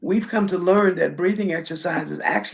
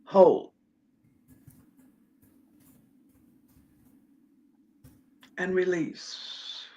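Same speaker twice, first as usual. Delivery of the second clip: guided breathing. Acted. Leads breathing exercise.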